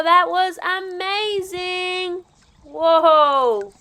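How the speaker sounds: ordinary delivery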